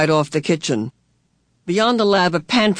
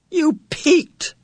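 indignant cry; 12 semitones higher than usual. A narrator's reading. Charles catches Missus Whatsit out, crying indignantly. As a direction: squeak it out in indignation. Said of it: "Charles cried indignantly".

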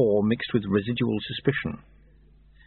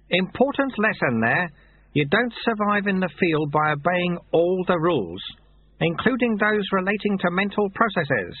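a hint of a gentle old sweeper, higher; lightly voiced old monk; 8 semitones higher than usual. A narrator's reading. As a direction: amiable old man reading a note. Lu Tse explains at length.

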